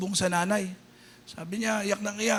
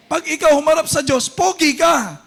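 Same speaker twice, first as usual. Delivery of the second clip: rousing shout; 6 semitones higher, 8 dB louder than usual. Acted. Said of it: "same line repeated with variation".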